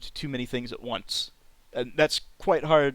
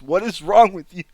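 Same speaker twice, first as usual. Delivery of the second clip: ordinary talk, nothing marked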